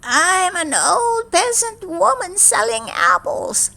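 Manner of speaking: high, slow crone voice; a sly old hag at the door; old witch voice. A narrator's reading. Disguised stepmother offers apples in a witchy old voice.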